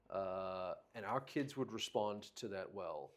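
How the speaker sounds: ordinary talk, nothing marked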